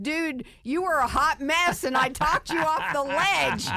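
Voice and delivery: high, loud, playful ribbing; teasing. Retold or imitated. Guest teasing the friend she once talked off the ledge, playfully high. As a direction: rib him that she saved him.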